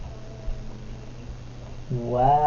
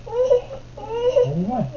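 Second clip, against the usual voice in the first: mimicking a whining toddler; 6 dB quieter, much slower than usual.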